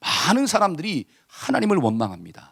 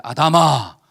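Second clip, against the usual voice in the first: loud, drawn-out summons; God calling Adam; 6 semitones lower, 4 dB louder, much slower than usual. Acted. God asks where Adam is hiding, calling out. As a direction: call out as God seeking Adam. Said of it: "하나님이 묻잖아요".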